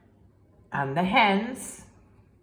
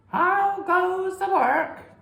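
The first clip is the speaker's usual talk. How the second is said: high, slow, loud lazy farmer call; 13 semitones higher, 5 dB louder, slower than usual. Acted. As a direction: call down from bed, drawn out. Lazy farmer calling from bed.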